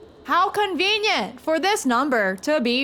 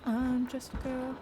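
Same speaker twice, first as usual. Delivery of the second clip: put-on soft girly voice; 9 dB quieter than usual. Repeated meme line.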